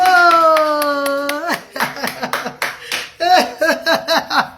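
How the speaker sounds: long delighted laugh; joyful high laugh. Real, not acted. Mother laughing happily after her son finally speaks. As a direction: laugh with relief and joy.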